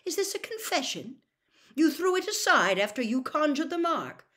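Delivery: high, incredulous questioning; disbelieving accusation. A narrator's reading. Official accuses the boy of a crime, incredulous. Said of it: "Mister Diggory in disbelief".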